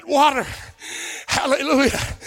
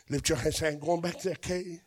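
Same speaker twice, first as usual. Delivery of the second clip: soft, low call for a response; hushed prompt to the congregation; quiet; 6 semitones lower, 13 dB quieter than usual. Real, not acted. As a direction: murmur it, almost to yourself. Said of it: "y'all too quiet".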